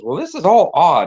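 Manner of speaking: mock-confused old lady; grandma playing dumb. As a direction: act innocently baffled at the caller. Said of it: "pretending she's dumb and".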